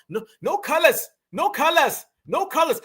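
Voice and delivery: a hint of a Dominican accent, mocking. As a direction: parody the salon's Dominican accent insisting on no colors. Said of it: "they say colors".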